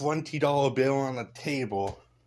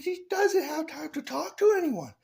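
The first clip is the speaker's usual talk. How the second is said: much higher, louder mocking squeal; mock retort; 5 dB louder than usual. Mocking the other side's retort, much higher and louder. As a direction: fire back in a shrill parody. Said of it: "And she's like".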